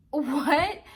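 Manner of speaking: sudden loud high yelp of disbelief; a disbelieving shout; shocked what. Retold or imitated. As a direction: blurt it out stunned and loud. Hears host will call police.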